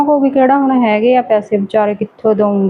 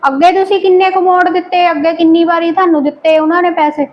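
higher pitched, sharp indignant questions; indignant retort; 6 semitones higher than usual. Acted. Wife challenging husband about never repaying, indignant. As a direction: throw his unpaid debts back at him.

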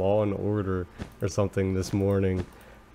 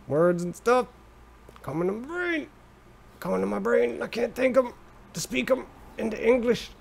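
silly dim voice; 12 semitones higher than usual. Acted. Joking about brain not working.

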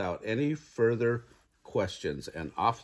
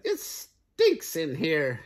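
higher, drawn-out hen voice; squawking hen; 6 semitones higher, much slower than usual. A narrator's reading. Hen complains about the stinky cave, squawking. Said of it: "squawked the hen".

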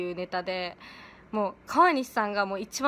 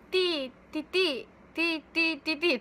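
lightly voiced higher comic ti chant; Chocolate Planet gag; 6 semitones higher than usual. A light gag impression for fans of Chocolate Planet. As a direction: rattle off the ti sounds like the comedy duo. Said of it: "チョコプラさん、".

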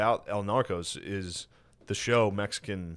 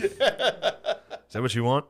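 slow, high, louder laughing scold; mock scolding; 5 semitones higher, 4 dB louder, much slower than usual. Friend mocking a fearmonger warning parents, slow scold. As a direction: drag it out like a scolding preacher.

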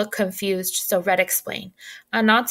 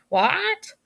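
higher, rising squawked what; hen's squawk; 9 semitones higher than usual. Acted. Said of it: "Betsy shrugged and squawked".

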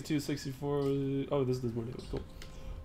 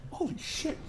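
high, stunned exclaim; amazed; 10 semitones higher than usual. Real, not acted. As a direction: gasp out the number. Amazed at damage.

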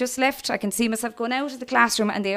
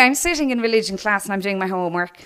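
normal talking voice

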